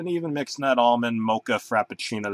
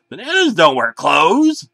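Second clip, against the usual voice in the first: louder, higher desperate yell; scream; 5 semitones higher, 7 dB louder than usual. A narrator's reading. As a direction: yell it at the bananas. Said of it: "I screamed".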